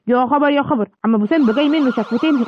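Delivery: ordinary talk, nothing marked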